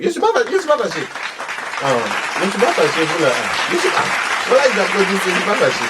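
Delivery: voix aiguë de vieille villageoise, criée fort; vieille maman fâchée. Acted. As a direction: une mère ivoirienne qui nie être fâchée en criant. Thérèse hurle qu'elle n'est pas fâchée. (Madame Thérèse, vieille dame aiguë qui proteste)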